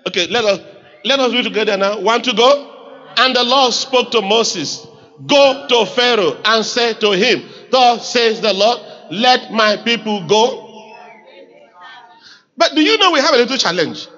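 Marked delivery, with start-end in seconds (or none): 3.2-10.7 firm, measured reading aloud; congregational scripture reading. Acted. Pastor reading God's command to Moses, firm measured reading. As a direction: read it out phrase by phrase.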